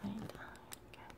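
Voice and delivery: soft unvoiced whisper. Real, not acted. Mother whispering to herself while feeding baby. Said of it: "whispering while feeding baby".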